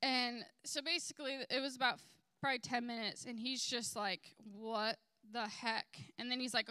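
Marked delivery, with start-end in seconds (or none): 4.4-6.1 imitated slow, drawn-out disbelief; stunned. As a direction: stretch each word as if dumbfounded. Retelling a stunned man's reaction.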